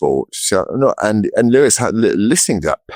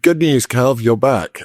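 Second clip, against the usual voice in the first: higher-pitched, playful good news; mock good-news announcement; 7 semitones higher, slower than usual. Acting out giving him good news.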